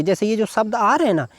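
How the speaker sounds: normal talking voice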